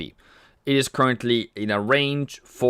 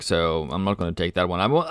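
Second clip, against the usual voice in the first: normal talking voice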